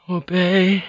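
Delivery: high, meek feminine voice; high-pitched subdued reply; submissive echo. Acted. Woman echoes the order meekly.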